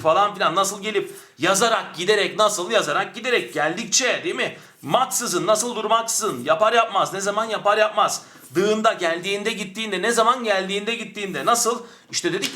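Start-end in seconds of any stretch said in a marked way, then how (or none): none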